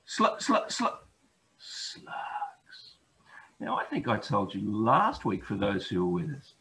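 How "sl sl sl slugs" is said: breathy build to a whisper; whispered stammer. A narrator's reading. Teasing the children with slugs, whispered at the end. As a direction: draw out the gross word in a hush.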